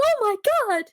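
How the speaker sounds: high, laughing, fake shock; playing it up as a dramatic gasp; hammed-up horror, pitched high; mock shocked cry. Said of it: "she's like".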